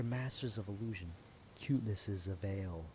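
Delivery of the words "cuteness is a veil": normal talking voice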